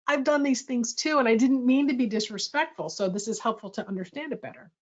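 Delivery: ordinary talk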